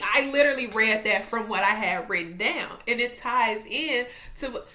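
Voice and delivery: usual speaking voice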